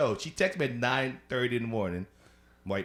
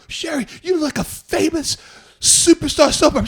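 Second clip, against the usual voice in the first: high, loud gushing flattery; flattering Sherry's looks, high and loud; gushing compliment; 13 dB louder than usual. Retold or imitated. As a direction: gush over her like a fan.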